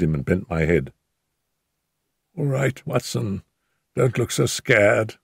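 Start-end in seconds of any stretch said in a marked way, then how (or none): 2.4-5.2 higher, weak, muttered sickbed voice. A narrator's reading. Injured Holmes reassures scared Watson, weak higher mutter. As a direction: mutter it faintly, like a beaten man in bed. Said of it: "he muttered in a very weak voice".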